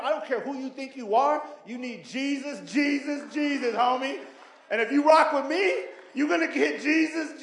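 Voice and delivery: loud, high preacher's build on Jesus; rally the crowd; fiery preaching. Acted. Preacher shouting Jesus at the congregation with fire.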